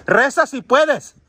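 drawn-out words called into the distance; slow calling out. Real, not acted. Calling to a lost child spirit. (llamando lento, en voz alta)

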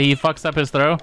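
usual speaking voice

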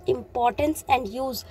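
importance and use spaced out word by word; slow heading dictation. Shown as a demonstration. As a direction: say the heading slowly for note-taking. Teacher repeats heading slowly.

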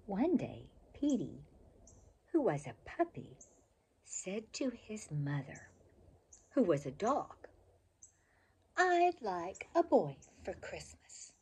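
put-on bright, lively storybook reading for kids; reading to children. A narrator's reading. Reading a favorite childhood book to children, lively storybook voice. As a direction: read it like a bedtime picture book, full of expression.

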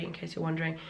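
ordinary delivery

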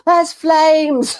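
high, loud imitation of shouting children. Mum mimicking her children as the cooking burns, shouting high. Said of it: "the children are shouting".

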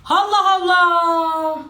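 very slow, drawn-out high "Allah Allah"; exasperated. Real, not acted. Annoyed at friends' talk, slow drawn-out disbelief. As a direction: stretch it out in disbelief.

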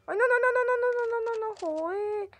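high frantic no no no; panicked screaming. Real, not acted. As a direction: shriek in real panic. Zombies overrun him, panic.

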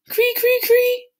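high, piping bird cry; soft falcon call. A narrator's reading. The falcon greeting Sam, a high bird call. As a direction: mimic a falcon's gentle cree. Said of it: "she called softly".

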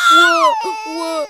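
put-on very high baby wail. Baby Simba wails at his mother, very high crying. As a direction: wail like a newborn at full pitch.

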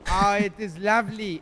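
ordinary delivery

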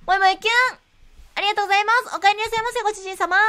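cutesy maid greeting; maid cafe voice. Pretend. Maid-themed shop.

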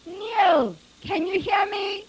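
high, loud calling into the distance; shouted. A narrator's reading. Father searching for his lost son, shouting. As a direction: call out hoping for an answer. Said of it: "he shouted".

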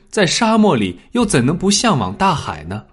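usual speaking voice

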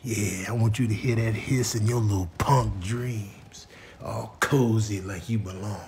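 a soft, low, threatening whisper; a hushed menacing cat voice; menacing hiss. Acted. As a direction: threaten the sleeping kitten. Cat hissing at kitten.

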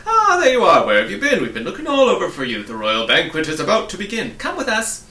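loud, high, urgent outburst; agitated. A narrator's reading. King's advisors rushing toward Milo, loud and agitated. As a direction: burst out loudly, hurrying them along to the banquet. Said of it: "They seemed very agitated and out of breath".

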